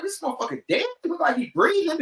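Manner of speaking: high, baffled question; puzzled alarm. Retold or imitated. Checking on the dog that won't move, high puzzled alarm. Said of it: "I'm like".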